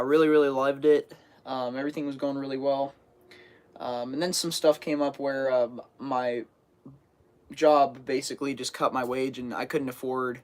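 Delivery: ordinary delivery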